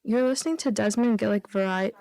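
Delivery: usual speaking voice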